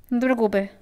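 normal talking voice

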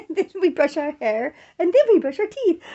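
put-on sing-song joke for a toddler; playful teasing. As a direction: tease the toddler with silly steps. Joking routine to baby.

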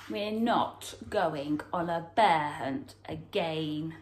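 plain reading voice

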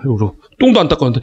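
ordinary delivery

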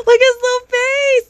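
gasp, then high gushing; overwhelmed by cuteness. Real, not acted. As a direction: coo over the little face. Gushing over penguin toy.

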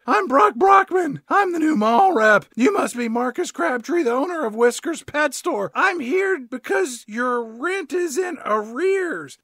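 high, put-on young upstart; nineteen-year-old kid. New mall rep introduces himself to store owner, high kid voice. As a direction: announce yourself loud and high, then ease slightly. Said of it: "the nineteen-year-old kid was like".

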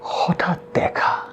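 breathless whispered gasp of হঠাৎ দেখা; a soft tearful whisper; hushed whisper. A narrator's reading. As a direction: whisper it as if struck by a sudden memory. Poem's sudden-meeting opening, whispered.

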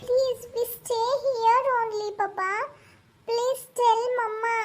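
put-on high, slow, pleading child; a small daughter begging her crying mother; sweet little girl pleading with mama. Child begs papa.

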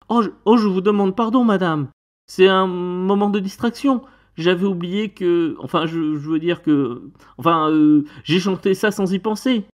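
high, stammering excuses; flustered apology. Acted. Tenant apologising to the witch, flustered. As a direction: stumble upward through a hasty apology. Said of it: "j'essaie de m'excuser".

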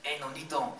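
loud, high-pitched hype-man cornerman; Robert Garcia impression. Cornerman hyping up his boxer, loud high impression. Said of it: "that's my Robert Garcia impersonation".

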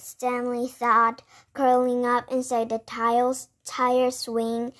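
usual speaking voice

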